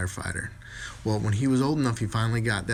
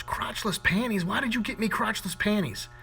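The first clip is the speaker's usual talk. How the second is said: shocked teacher; 11 semitones higher than usual. Acted. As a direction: gasp it out in disbelief.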